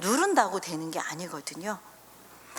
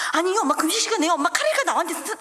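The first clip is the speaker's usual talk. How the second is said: 조르는 아이 흉내; 6 semitones higher, 3 dB louder, faster than usual. Retold or imitated. 아이 목소리 흉내.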